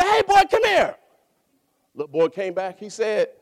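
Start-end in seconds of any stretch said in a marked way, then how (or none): 0.0-1.0 put-on high, loud call across the yard: Hey, boy, come here. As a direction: shout for the boy to come back. Preacher calls boy back.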